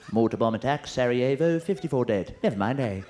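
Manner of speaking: mock television news anchor; Trevor McDonald. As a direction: read grim headlines, then the catchphrase in the same anchor voice. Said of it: "you have Trevor McDonald on there".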